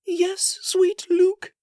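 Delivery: high, soothing motherly voice; gentle mother. Acted. Queen promising her son a tray, soothing. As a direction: reassure a sick child.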